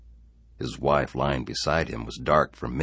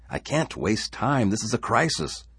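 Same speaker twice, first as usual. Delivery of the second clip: urgent protest; 9 semitones higher, 3 dB louder than usual. Acted. Harry to wife wanting a swim, urgently protesting. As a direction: push back with raised pitch, alarmed.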